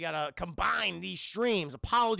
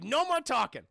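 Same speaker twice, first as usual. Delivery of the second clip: higher, louder acted order; mock command; 5 semitones higher, 5 dB louder than usual. Unnamed forces shut down the streamer, mock command. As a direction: imitate someone cutting off a talker. Mimics those who cut stream.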